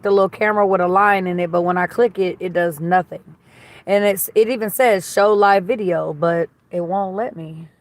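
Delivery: ordinary talk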